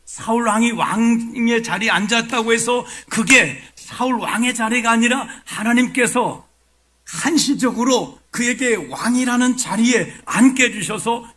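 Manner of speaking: high, loud pulpit proclamation; forceful preaching. Real, not acted. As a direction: drive the point home to the congregation at full force. Preaches the point at full force.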